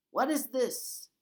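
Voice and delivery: loud, high suspicious demand; suspicious guard. A narrator's reading. Guard holds up the rucksack, loud and suspicious. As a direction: demand an answer.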